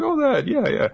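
very high dismissive voice; offhand old man. Retold or imitated. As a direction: shrug it off. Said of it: "it's like".

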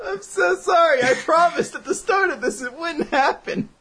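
apologizing through laughter; laughing. Real, not acted.

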